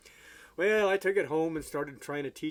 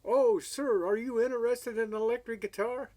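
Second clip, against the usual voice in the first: much higher, fawning pawn shop salesman voice; eager salesman; 9 semitones higher, 4 dB louder than usual. Retold or imitated. Pawn shop salesman pitching a guitar to a customer, high fawning voice. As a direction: pitch it up high like an overeager clerk making a sale. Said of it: "this funny little guy runs up to me and says".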